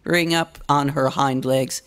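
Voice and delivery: plain reading voice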